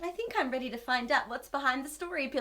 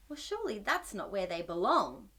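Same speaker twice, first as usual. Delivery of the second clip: ordinary delivery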